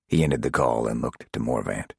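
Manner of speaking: usual speaking voice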